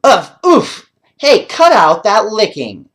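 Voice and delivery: high, loud laughing protest; loud playful boy's whoop. Acted. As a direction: yelp it while being licked. Boy Paul pinned by dog.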